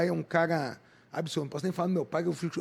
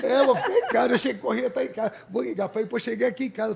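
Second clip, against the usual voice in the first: laughing while telling; 6 semitones higher, 7 dB louder than usual. Real, not acted. Laughing recalling skipping the run.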